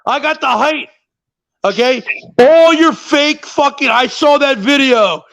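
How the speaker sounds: ordinary delivery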